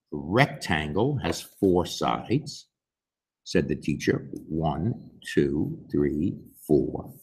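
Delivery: teacher counting. Acted. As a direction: count the sides slowly for the kindergartners.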